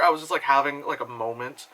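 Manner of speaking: ordinary delivery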